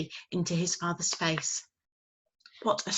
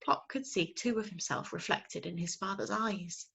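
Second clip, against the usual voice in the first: usual speaking voice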